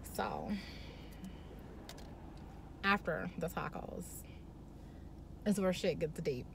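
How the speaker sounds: hushed storyteller setting up the juicy part; hushed build-up. Real, not acted. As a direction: drop to a near whisper. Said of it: "dramatic hush before the reveal".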